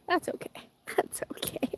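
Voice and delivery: laughing, self-soothing whisper; soft whisper. Real, not acted. Vlogger reassuring herself after spilling coffee, whispering. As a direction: whisper it to calm yourself down.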